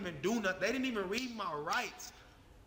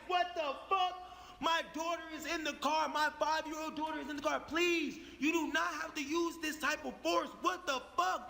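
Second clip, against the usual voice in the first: high-pitched, incredulous protest; alarmed pleading; 6 semitones higher than usual. Acted. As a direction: plead urgently with armed police for your child. Acts out pleading with armed police.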